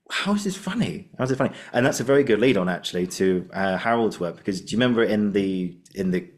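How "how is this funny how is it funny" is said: high, puzzled how is this funny; a light mock bewilderment; baffled disbelief. Puzzled listener at a joke, lightly baffled and high. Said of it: "And I'm going".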